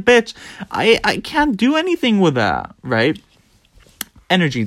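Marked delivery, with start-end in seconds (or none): 0.0-2.7 high, exasperated comeback; mock-outraged protest in a high voice; exasperated retort. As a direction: act out an indignant reply, pitched well up. Acted exasperated reply to listing.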